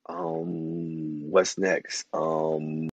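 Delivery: drawn-out ums, low and quiet; stalling for ideas. Retold or imitated. Mother stuck while listing foods, stalling. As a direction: stretch the um while searching for the next word. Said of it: "I'll start saying stuff like".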